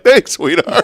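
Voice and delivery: laughing, dry thanks; mock thanks. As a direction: thank her through a laugh. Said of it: "I was like".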